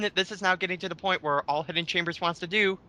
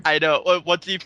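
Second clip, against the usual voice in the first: ordinary delivery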